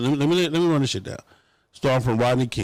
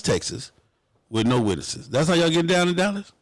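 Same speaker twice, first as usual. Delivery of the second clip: ordinary talk, nothing marked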